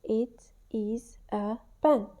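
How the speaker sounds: "It is a pen" slow, each word separate; one word per finger, slowly. Shown as a demonstration. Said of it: "giving each finger a word".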